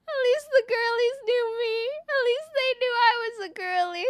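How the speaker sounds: hammed-up tearful squeal; mock wailing. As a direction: whine it out in exaggerated despair.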